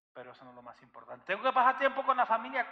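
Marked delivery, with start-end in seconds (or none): 1.3-2.7 loud, much higher complaining voice; mock excuse. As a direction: whine it like a member making excuses. Mimicking a church member's excuse. (excusa burlona, en voz alta y mucho más aguda)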